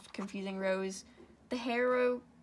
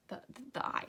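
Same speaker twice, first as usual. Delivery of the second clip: whispered fumble; 3 semitones higher, 4 dB quieter, much faster than usual. Real, not acted. Fumbling for the word.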